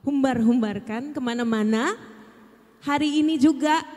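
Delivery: loud, high vow; emphatic vow. Retold or imitated. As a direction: declare it with full force. (sumpah lantang dan tinggi)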